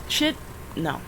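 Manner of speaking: ordinary talk, nothing marked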